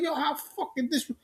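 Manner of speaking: very high, soft squeal of disbelief; incredulous, pitch shooting up; retold outrage. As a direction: replay being mad in a thin high voice. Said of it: "I was so mad when he said that".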